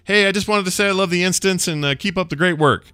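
high, cheery caller voice. Retold or imitated. Imitating a fan caller for his co-host. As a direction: chirp it like an eager fan leaving a message. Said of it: "someone will call in and say".

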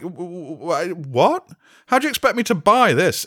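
high, stammering disbelief; incredulous stammer. Retold or imitated. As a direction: splutter in disbelief. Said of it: "I was sat there like".

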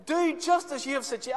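high, loud eager order; a king ordering the robe; excited command. Acted. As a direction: snap it out with glee.

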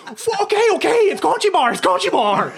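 mock panicked giving in. To the whipping captain, laughing panicked surrender. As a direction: squeak out a frantic okay. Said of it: "so I'm like".

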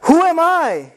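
high, loud questioning cry; Moses asking who am I. Acted. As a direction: cry out in disbelief. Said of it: "That was what Moses was asking".

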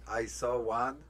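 usual speaking voice

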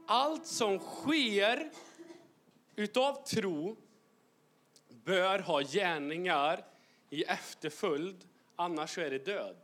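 slow, weighty sermon delivery; solemn preaching. Acted. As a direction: drag out each phrase for weight. Preaching slowly over music. (långsam, tung predikan)

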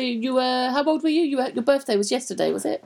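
normal talking voice

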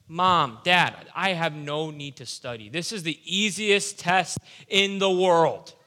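hammed-up overconfidence, stretching every boast out to the parents; cocky big shot. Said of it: "you act like a big shot".

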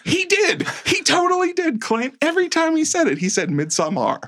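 high, triumphant insistence; excited. Real, not acted. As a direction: gloat that you were right all along. Excited vindication about pronunciation.

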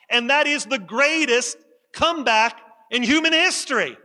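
rising, emphatic pulpit delivery; preaching. Acted. Pastor urging his congregation to study the resurrection, preaching. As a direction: preach it to the congregation with growing force.

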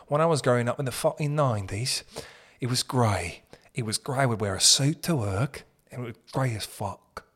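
imitated London accent. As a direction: reminisce like a Londoner. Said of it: "that guy does sound like he's from Lo".

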